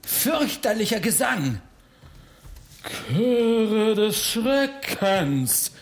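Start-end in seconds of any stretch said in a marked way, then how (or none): 3.1-5.7 very slow, drawn-out, higher-pitched growl. Acted. Baron summons the choirs of terror, growling. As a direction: growl it out grandly, stretching every word. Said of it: "knurrte der Freiherr".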